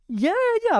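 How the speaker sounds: very high, squealed mock agreement; over-eager agreement. Host agreeing with friend's self-put-down, over-eager.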